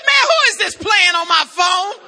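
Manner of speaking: loud, irritated phone yell; annoyed shout. Retold or imitated. Thinks a friend is pranking him.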